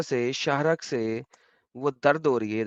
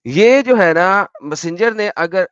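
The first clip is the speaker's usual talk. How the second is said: emphatic; 5 semitones higher, 10 dB louder, slower than usual. Real, not acted. Emphatic reading of verse.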